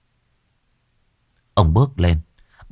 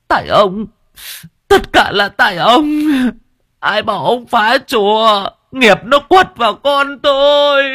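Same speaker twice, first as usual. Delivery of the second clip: much higher, louder, sobbing blame; tearful accusation; 5 dB louder than usual. Acted. Wife pounding her husband's back, screaming blame through tears.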